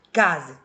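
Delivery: Casa said loud with the first syllable punched; stressed syllable demo. Shown as a demonstration. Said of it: "O K é a tônica, a mais forte".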